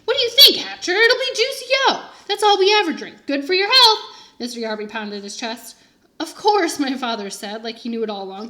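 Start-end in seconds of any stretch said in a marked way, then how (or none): 0.1-4.0 loud, high and hearty; booming. A narrator's reading. Booming Mr. Yarby. 6.2-6.8 eager agreement. A narrator's reading.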